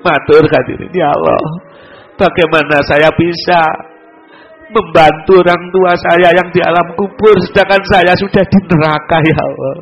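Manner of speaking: rising, imploring preacher's voice; pleading sermon. Acted. Preacher crying out to God for his suffering parents, imploring sermon. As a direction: beg for help as if from hell on earth.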